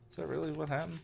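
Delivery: usual speaking voice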